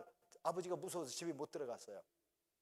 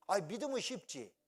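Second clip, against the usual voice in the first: ordinary talk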